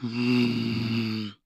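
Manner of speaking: low, soft satisfied hum; low yummy hum. Acted. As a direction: hum low like tasting something good. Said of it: "He makes a yummy sound".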